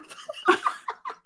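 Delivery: high breathy laughing shriek. Real, not acted. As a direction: burst into a squealing laugh. Loud burst of laughter.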